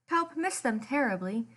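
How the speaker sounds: ordinary delivery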